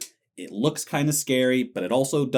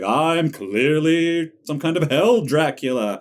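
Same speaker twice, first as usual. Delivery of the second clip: loud, slow, higher mock villain voice; mocking Pinhead; 5 semitones higher, 5 dB louder, slower than usual. Podcaster mocking Pinhead to co-host, hammy villain. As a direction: ham up a self-announcing movie monster. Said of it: "Pinhead shows up and he's like".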